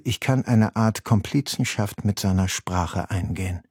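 ordinary talk

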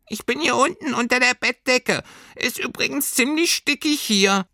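very high little furry creature; squeaky muffled voice. A narrator's reading. Hidden creature talking from under a stuffy duvet, squeaky. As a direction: pipe up in a tiny creature voice. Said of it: "eine piepsige, gedämpfte Stimme".

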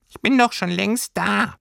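sehr hohe Tierchenstimme; piepsiges Stimmchen. A narrator's reading. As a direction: melde dich als kleines Fabelwesen, ganz hoch. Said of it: "hörte sie das Stimmchen". (hohes Figurenstimmchen)

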